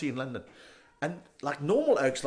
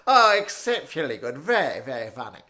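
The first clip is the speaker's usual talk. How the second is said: loud, higher posh British voice; be amused in hammed-up upper-class British; stiff-upper-lip British amusement; 6 semitones higher, 8 dB louder than usual. Chelsea barrister praising a comic, stiffly posh British. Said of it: "laugh with a stiff upper lip".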